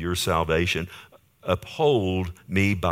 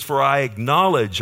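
retold cry, raised and louder; 6 semitones higher, 6 dB louder than usual. Preacher quotes David confessing to God, crying out. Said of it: "That's what David's crying out".

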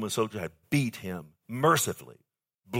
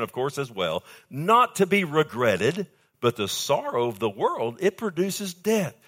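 loud, ringing pulpit delivery with the voice raised; full-force preaching; 3 semitones higher, 6 dB louder than usual. Acted. Preacher proclaiming scripture to his church, loud and fervent. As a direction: preach it out to the congregation at full volume.

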